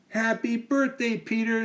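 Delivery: put-on high girlish greeting; Amy's voice. Amy greets Peter at his party, high girl voice. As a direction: greet him sweetly as a girl. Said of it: "said Amy".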